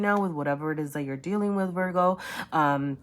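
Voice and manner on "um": choking up. Real, not acted. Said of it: "I'm, like, really choking up here".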